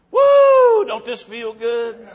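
high loud whoop, then slow and happy; joyful whoop. Retold or imitated. Raptured saints on the platform, whooping with joy. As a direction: celebrate like you just got to heaven. Saved people celebrating in heaven.

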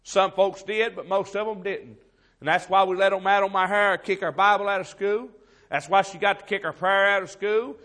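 loud, driving pulpit preaching; full-force preaching. Acted. Preacher rails at the church, loud full-force preaching. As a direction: hammer it home to the congregation.

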